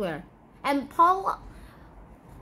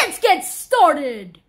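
hype; 8 dB louder, much slower than usual. Acted. Intro catchphrase.

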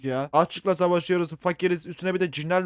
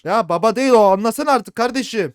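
loud, higher frustrated yell; exasperated shout; 6 semitones higher, 10 dB louder than usual. Real, not acted. Gamer yelling at child that it's not dad, exasperated.

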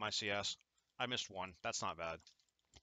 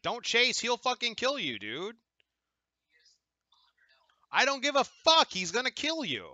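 yelling warning; 12 semitones higher, 6 dB louder than usual. Real, not acted.

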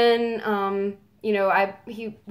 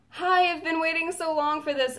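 high, gushing fan hello; retelling an eager hello; retold excited greeting; 8 semitones higher than usual. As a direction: greet your idol, pitched up. Retold greeting to her idol.

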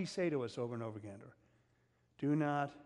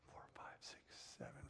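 whispered counting; 20 dB quieter than usual. Real, not acted. Counting verses under breath.

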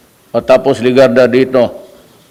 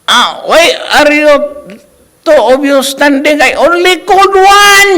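much higher put-on voice of a fussy law professor; mock professor; 14 semitones higher, 5 dB louder than usual. Professor scolding two students standing in class, high mocking impersonation. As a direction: play the strict professor in a high, slowed mocking voice. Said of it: "tinanong niya".

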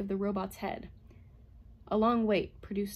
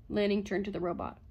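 plain reading voice

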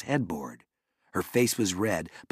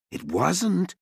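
insisting; 10 semitones higher than usual. A narrator's reading. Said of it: "Jared insisted".

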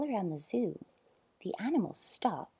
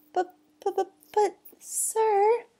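high, louder stammering protest; flustered stammer; 13 semitones higher, 6 dB louder than usual. Acted. Boy protesting his ban, flustered stammer. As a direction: stammer helplessly, pitched up.

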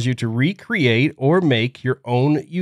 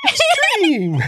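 high, drawn-out hype voice; hyped announcer. Pretend. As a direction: sell it like a trailer. Echoing title, hyped.

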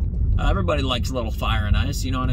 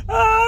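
a sudden shrieking scream, much higher and louder; panicked scream; 10 dB louder than usual. Pretend. Caught in a tornado, a terrified high scream. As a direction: scream as the tornado spins you. Imagined spinning inside a tornado.

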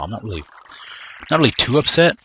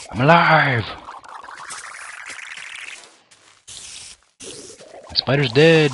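excited cheer; 6 semitones higher, slower than usual. Real, not acted. Survives fight, cheers.

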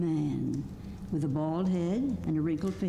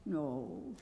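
meek; 2 semitones lower, 10 dB quieter, much slower than usual. A narrator's reading. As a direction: correct them gently and quietly. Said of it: "said the little man meekly".

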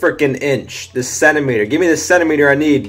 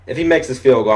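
ordinary talk

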